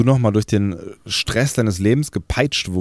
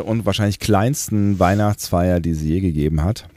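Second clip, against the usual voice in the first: ordinary talk, nothing marked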